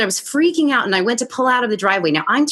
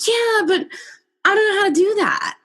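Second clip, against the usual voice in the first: mock helpless whine; 10 semitones higher than usual. Mock helpless reply to guru.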